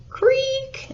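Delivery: very high squeaky creak; voiced imitation of a high creak; creaking floor. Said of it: "And the floor went".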